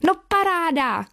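put-on excited boy's exclamation, high and falling; nadšený výkřik. A narrator's reading. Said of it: "křičel nadšeně Jozífek". (nadšený klučičí výkřik)

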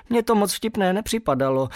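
a bit hurt. A narrator's reading. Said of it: "zatvářil jsem se trochu ublíženě".

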